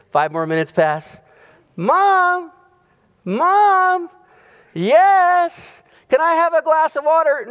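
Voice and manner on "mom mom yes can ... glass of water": put-on high small boy's voice calling out from bed; little boy calling. Little boy calling his mother from bed, high childlike voice. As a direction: pitch way up like a kid calling his mom.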